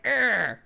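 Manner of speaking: loud, high mock roar; aggressive growl. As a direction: charge in with a big pirate-like roar. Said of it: "we don't wanna be like".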